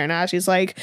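ordinary talk

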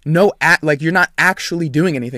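emphatic, angry dressing-down; pissed off. Retold or imitated. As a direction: drag out the command, then tell him off. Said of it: "'cause I was pissed".

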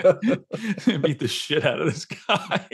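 helpless laughter stretching out the words; laughing hard. Real, not acted. Host cracking up as he retells the kill scene, laughing. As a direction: crack up through the whole line, high and drawn out.

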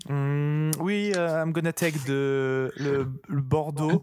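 put-on slow, heavy French-accented English; mock French accent in English. French customer ordering wine from the waiter, slow heavy French accent.

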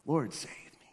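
hushed, breathy plea; quiet desperate plea; retold cry for help. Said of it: "he just cries out".

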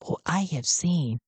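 whispered secret; conspiratorial whisper. Acted. Garn whispers a secret to Sven up close. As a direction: lean in and whisper it.

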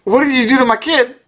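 high-pitched mock dismay over his kid; mock anguished cry. Fan reliving the kid being swallowed, high mock dismay. Retold reaction to kid eaten.

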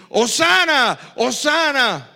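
loud high cries of praise; crowd shouting. Retold or imitated. Preacher recalling the same crowd's praise, loud shout. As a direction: call it out like the crowd did. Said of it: "mucha de esa multitud que gritó".

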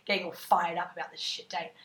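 usual speaking voice

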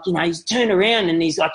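usual speaking voice

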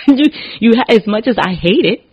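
sudden high, loud outburst; excited outburst. Real, not acted. Bursting out about death.

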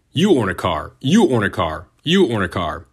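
big booming giveaway announcement, YOU soaring each time; hammed-up talk-show host handing out prizes, punching every "you"; Oprah Winfrey. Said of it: "that's my best Oprah impression".

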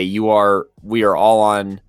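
normal talking voice

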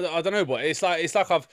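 ordinary delivery

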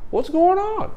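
very high put-on voice; imitated questioning. Sergeant answering his door, imitated high question. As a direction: ask it with pitch way up.

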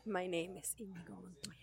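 soft mock Spanish accent; Inigo Montoya. Showing how the film quote should go, put-on Spanish accent. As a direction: quietly deliver the film line in a Spanish accent. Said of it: "you have to do it with an accent".

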